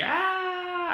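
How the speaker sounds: long, high, held "aaah" scream; hammed-up scream of a wave victim; mock scream.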